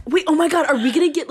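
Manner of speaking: sudden excited burst, high and loud. Real, not acted. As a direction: blurt it out, thrilled.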